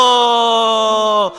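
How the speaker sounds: long drawn-out loud cry of 주여. Real, not acted. Congregation led by pastor crying out 주여, long loud shout. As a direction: call out to the Lord with full force. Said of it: "주여 한번 외치고".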